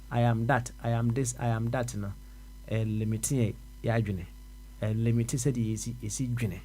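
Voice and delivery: normal talking voice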